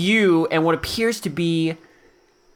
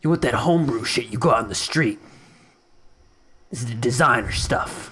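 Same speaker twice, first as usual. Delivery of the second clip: gruff shopkeeper voice; 5 semitones lower than usual. Acted.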